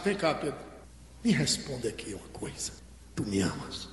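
very low, soft acted voice; hushed, deep confidential question; private question. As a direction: drop low, as if taking someone aside. Jesus takes Peter aside privately.

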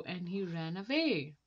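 ordinary delivery

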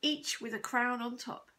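ordinary delivery, nothing marked

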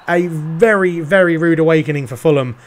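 lightly done play-by-play commentator voice; TV football commentary. Acted. Gamer narrating his FIFA match to viewers, light commentator style. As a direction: call the match like a TV pundit.

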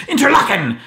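loud booming Interlaken as thunder; thunder sound. Shown as a demonstration. Trying another thunder, booming Interlaken!. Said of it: "I'll do it differently".

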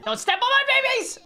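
high-pitched protective cry; sudden playful outburst; mock alarmed yell. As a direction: yell it in comic panic. Yelling to protect game babies.

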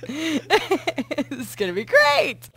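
very slow, laugh-filled words; slow words broken by laughter. Real, not acted. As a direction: squeeze it out between laughs.

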